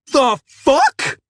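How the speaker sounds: very high, loud outraged scream. A narrator's reading. Splashed customer screams at the kid. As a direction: scream in shock. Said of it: "he screamed".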